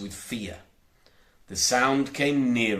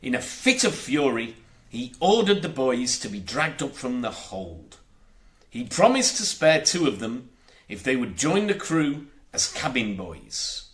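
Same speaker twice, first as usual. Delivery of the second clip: plain reading voice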